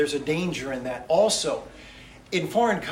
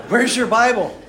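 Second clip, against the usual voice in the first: louder repeat; 5 semitones higher, 6 dB louder than usual. Retold or imitated.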